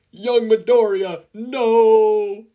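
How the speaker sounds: loud, high, slow cry of alarm; horrified shout. Acted. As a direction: bellow it out in shocked protest. Mentor horrified by masochist idea.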